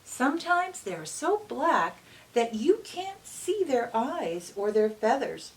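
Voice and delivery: bright, lively read-aloud voice; reading to children. A narrator's reading. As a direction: read it like storytime for little kids. Reads picture book aloud.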